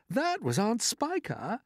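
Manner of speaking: high, slow cheer rising at the end; gleeful shout. Acted. Centipede cheering the second bump, high whoop. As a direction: sing it out like a victory cry. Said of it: "the centipede had shouted".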